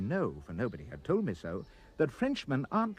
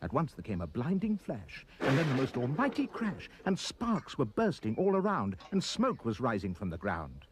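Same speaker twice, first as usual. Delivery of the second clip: usual speaking voice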